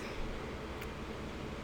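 breathy exaggerated gasp; mock gasp. Real, not acted. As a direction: gasp in fake shock. Reacts to corsets topic.

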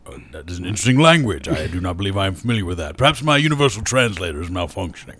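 low, formal alien speech; alien character voice. Retold or imitated. Voha answers the hyper kid in a low, stiff alien voice. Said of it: "I'm like".

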